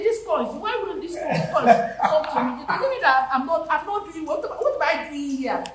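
high laughing grievance; laughing complaint. Retold or imitated. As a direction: laugh while you complain you're left out. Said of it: "I say".

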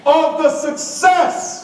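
very high, stretched-out call; rally the crowd, high and slow, giving everything; all-out rallying cry. Shown as a demonstration. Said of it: "I'm gonna give it everything I got".

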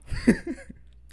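squeaky laugh; bursting laugh; high laugh. Real, not acted. Laughs at own mistake.

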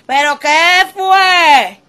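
"¿Pero qué fue?" stretched very slowly, "fue" drawn out and falling; drawn-out catchphrase. Shown as a demonstration. Vlogger closing with her catchphrase, drawn out very slowly. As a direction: drag out every word of "¿Pero qué fue?". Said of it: "catchphrase drawn out".

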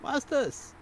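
high, squeaky servant's call; a high-pitched minion calling for his masters; Nip's voice. Acted. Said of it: "you can hear Nip's voice".

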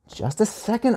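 soft, lilting request to wait; putting off. A narrator's reading. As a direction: gently hold off a child. Teacher puts Anya off.

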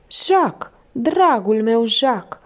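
high, slow lament for a loved one; sobbed refrain. A narrator's reading. Felicie crying at the kitchen table, lamenting. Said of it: "se auzeau aceleași silabe".

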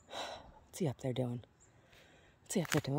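a quiet low aside to herself; hushed aside. Real, not acted. Gardener wondering under her breath about him, hushed. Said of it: "murmured aside about someone".

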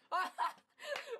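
loud high cackle; loud high laugh. Real, not acted. Surprised he can type sex, a loud high laugh. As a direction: let out a loud squealing laugh.

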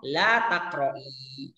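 la taqra'i drawn out very slowly with a clear final i; slow model form. Shown as a demonstration. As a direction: stretch la taqra'i slowly for the students. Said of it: "teacher models feminine form". (la taqra'i diucapkan sangat pelan dan panjang)

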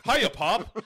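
high, loud mock-eager son; Lon Chaney Junior. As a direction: call out like a hammy movie son. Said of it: "Lon Chaney Junior to Claude Rains".

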